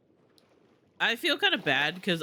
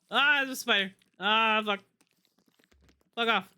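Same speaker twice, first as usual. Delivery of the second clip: startled and scared; 5 semitones higher than usual. Real, not acted. Spider appears in game.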